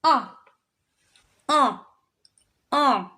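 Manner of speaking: Un as a short nasal vowel; French un. Shown as a demonstration. Teacher contrasting un for students, nasal. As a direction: say Un nasal and clipped, no N sound. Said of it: "So this is how it's read".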